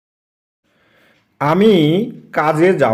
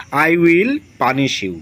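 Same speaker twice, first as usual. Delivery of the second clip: slow dictation; 5 dB louder, much slower than usual. Shown as a demonstration. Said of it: "কি লিখতে পারি বা কি বলতে পারি?".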